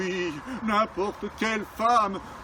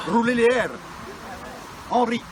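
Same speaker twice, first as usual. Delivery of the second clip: Gascon accent. Shown as a demonstration. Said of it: "parler avec l'accent du Sud ... Gascon".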